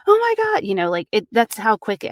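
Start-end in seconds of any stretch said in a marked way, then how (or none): 0.1-0.6 high, loud gasp of alarm; mock horror, much higher and louder; sudden panic. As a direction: snap from calm into shock. Imagined sudden panic, whiplash.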